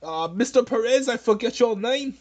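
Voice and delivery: put-on a clumsy send-up of Bernie Sanders' accent, pleading and apologetic; hammed-up Bernie Sanders accent, sorry and submissive; bad Bernie Sanders accent. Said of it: "This is a bad accent".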